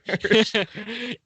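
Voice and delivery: high burst of laughter. Real, not acted. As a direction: laugh at the slip. Laughing at misquote.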